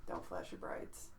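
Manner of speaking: said very softly, nearly voiceless; quiet repeat to self. Real, not acted. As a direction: repeat it under your breath. Repeats the remembered saying quietly.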